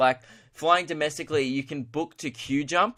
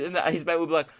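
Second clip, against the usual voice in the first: usual speaking voice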